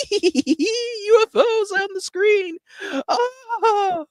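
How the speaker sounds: very high, slow, squealing with delight; giddy schoolgirl. Retold or imitated. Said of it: "two giddy little schoolgirls".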